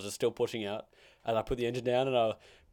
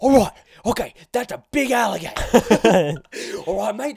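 loud, high, over-excited Australian wildlife presenter; Steve Irwin; 11 semitones higher, 13 dB louder than usual. Acted. Wildlife man spotting a big alligator, excited Australian shout. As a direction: shout it with huge Aussie enthusiasm. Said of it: "He's so enthusiastic".

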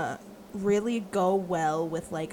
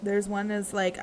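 ordinary delivery

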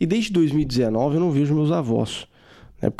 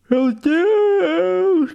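shocked; 4 dB louder than usual. Real, not acted. Shocked by race result.

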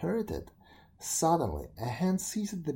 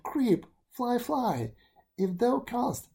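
put-on gentle girl; 10 semitones higher, 3 dB louder, slower than usual. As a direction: coax the beetle kindly. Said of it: "she said".

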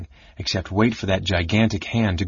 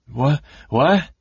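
startled, high stammer; befuddled and higher-voiced; babbling; 5 semitones higher, 3 dB louder than usual. A narrator's reading. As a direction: babble it out, pitched up in confusion. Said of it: "Caramon was babbling".

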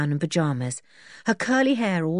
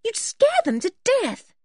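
higher-pitched character line; lightly voiced story character; 3 dB louder than usual. A narrator's reading. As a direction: lift the voice much higher. Character speaks in story dialogue.

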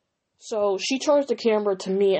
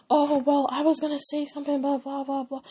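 higher, mocking imitation of a friend; high put-on voice for a retold excuse; mock friend's excuse; 6 semitones higher than usual. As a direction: imitate her lame excuse in a high-pitched voice. Retelling friend's feeble excuse.

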